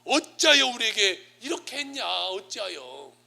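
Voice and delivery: acted reproach, starting high and loud, then dropping; mock parental protest, high start; indignant complaint. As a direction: burst out with displeasure, then let it fall. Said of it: "불만을 팍 표출하는 거예요".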